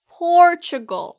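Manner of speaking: high, loud Portugal as "POR-chuh-gul"; emphatic repeat-after-me Portugal, front-stressed; pronunciation drill. Shown as a demonstration. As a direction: stretch POR in Portugal a little. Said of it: "그럼 같이 발음해 볼까요".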